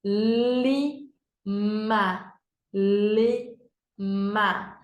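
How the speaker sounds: Lima split into "li... ma"; syllable by syllable. Shown as a demonstration. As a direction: say Lima slowly in two separate beats. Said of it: "Dois pedacinhos". (Lima em sílabas: "li... ma")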